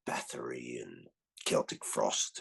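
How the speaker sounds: low, slow whisper of band names. Real, not acted. Telling the interviewer the forbidden bands, whispered. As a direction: hush the names like contraband.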